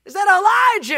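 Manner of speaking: high, loud panicked shout; high startled shout of fear; frightened cry. Acted. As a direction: yelp in dread at the sight. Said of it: "tell me it's not him".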